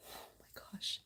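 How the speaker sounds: hushed whisper of awe; breathy awed whisper to herself. Real, not acted. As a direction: whisper as if afraid to break the moment. Awed by card, unvoiced.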